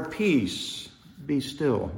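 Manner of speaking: ordinary talk, nothing marked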